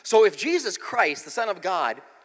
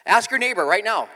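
ordinary talk

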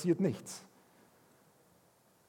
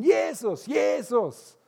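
even more desperate high shout; calling ever louder; 9 semitones higher, 4 dB louder, much slower than usual. Retold or imitated. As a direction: yell it louder over the crowd. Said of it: "Er wird immer lauter".

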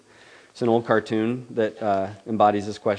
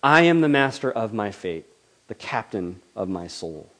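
poem recitation; 2 semitones higher, 5 dB louder than usual. Acted. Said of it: "it ends like this".

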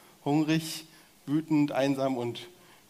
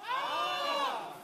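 very high cry; high scream; 7 dB quieter than usual. Shown as a demonstration. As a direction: cry out high, leading everyone. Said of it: "Einmal bitte alle". (hoher Schrei zum Mitmachen)

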